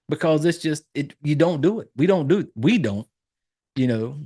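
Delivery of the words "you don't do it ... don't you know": usual speaking voice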